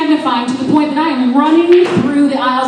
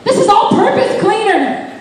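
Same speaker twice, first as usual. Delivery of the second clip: high, slow, laughing exasperation; exasperated friend; 10 semitones higher, slower than usual. Retold or imitated. Friend holding the cleaner bottle, exasperated. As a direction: scold her through laughter. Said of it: "they're like".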